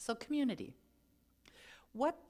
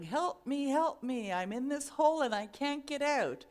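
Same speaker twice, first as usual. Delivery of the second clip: higher, louder calls for help from a hole; mock yelling for help; 6 semitones higher, 6 dB louder than usual. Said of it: "And he's yelling".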